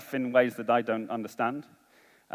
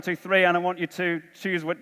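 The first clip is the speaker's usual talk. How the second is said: ordinary delivery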